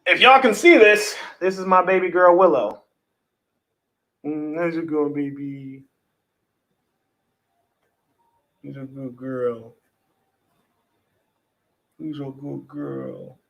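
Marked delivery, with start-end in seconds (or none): 4.2-13.4 soft, low cooing to a cat; tender pet talk. Real, not acted. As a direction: croon slowly and softly to your pet. Baby-talking to pet cat Willow.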